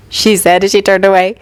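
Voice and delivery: plain reading voice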